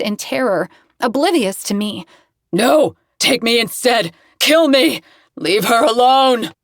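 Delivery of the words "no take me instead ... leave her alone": high, loud terrified pleading; screaming. Acted. Sin screaming in his nightmare, high loud terrified pleading. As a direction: scream to save her, slower at the end. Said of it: "He continues to scream".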